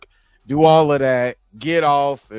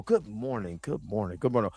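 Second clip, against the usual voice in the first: fading echo; 9 semitones lower, 10 dB quieter, faster than usual. Pretend. Echo fade on repeated greeting.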